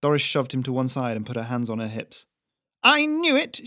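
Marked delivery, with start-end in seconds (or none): 2.8-3.7 put-on shrill high old-lady shout; triumphant shout. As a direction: crow it out in triumph. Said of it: "she shouted".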